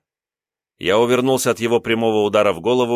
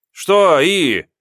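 sharp question; 8 semitones higher, 6 dB louder than usual. Acted. Raised, confrontational question.

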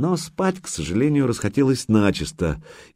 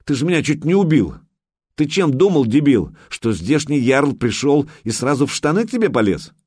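indignant, higher and louder outburst; angry scolding; 7 semitones higher, 4 dB louder than usual. A narrator's reading. Alyona scolds the man who nearly strangled her, indignant. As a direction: berate him in outrage. Choked woman berates him.

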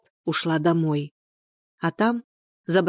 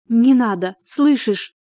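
higher, alarmed plea; frightened cry; 5 semitones higher, 4 dB louder, slower than usual. A narrator's reading. Людмила begs her friend not to report it, frightened. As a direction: plead urgently out of fear. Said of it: "испуганно закричала Людмила".

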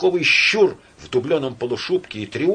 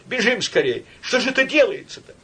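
high, alarmed cry; panicked bystander; 9 semitones higher, 3 dB louder than usual. A narrator's reading. Bystander fleeing the brawl with Marusya, panicked. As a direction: urge her to run in alarm. Frightened bystander urges flight.

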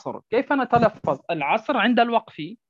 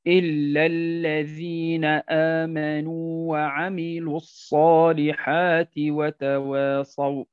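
slow, low, even-toned tajweed; measured chant-like recitation on a level pitch; Quran recitation; 4 semitones lower, much slower than usual. Shown as a demonstration. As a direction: recite the verse with long held vowels.

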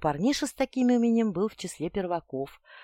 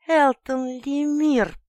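slow hissed name, higher; 8 semitones higher, 6 dB louder, much slower than usual. A narrator's reading. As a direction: hiss the name out slowly. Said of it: "прошипела подруга".

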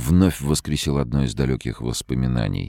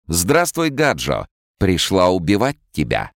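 fortune teller; 6 semitones higher than usual. Acted. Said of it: "её чёрные глаза смеялись".

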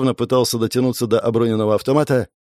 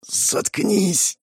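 higher-pitched, restrained order; pained demand; 8 semitones higher than usual. A narrator's reading. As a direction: demand silence through a splitting headache. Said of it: "потребовал я и зажал руками раскалывавшуюся голову".

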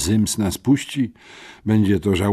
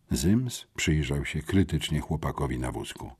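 ordinary delivery

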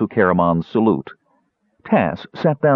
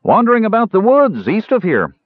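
high, louder ranger's voice; hearty tavern ranger; 5 dB louder than usual. A narrator's reading. Ranger telling strangers where she went, hearty. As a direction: toss out directions loudly.

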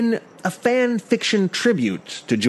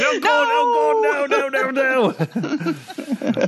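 high, drawn-out pleading wail; comic high-pitched terror; mock panic; 12 semitones higher, slower than usual. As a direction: beg in hammed-up horror. Husband begs witch wife, panicking.

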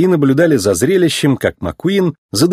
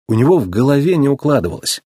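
usual speaking voice

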